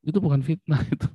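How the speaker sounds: usual speaking voice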